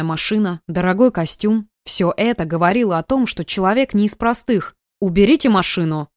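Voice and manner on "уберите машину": plain reading voice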